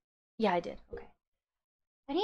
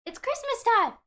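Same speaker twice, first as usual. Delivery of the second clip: high, loud festive cheer; excited; 12 semitones higher, 8 dB louder than usual. Acted. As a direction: shout it out with festive glee. Festive greeting on stream.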